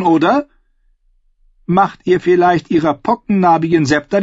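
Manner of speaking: mocking, icy interrogation; queen sneering at her maester; cold queen. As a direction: pick him apart with scorn.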